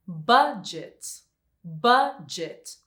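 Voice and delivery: "budget" with the first syllable stressed, then falling. Shown as a demonstration. Teacher giving an example of unstressed endings, "budget" stressed on its first syllable. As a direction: hit the start of "budget", drop the end. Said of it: "if the last syllable is not stressed".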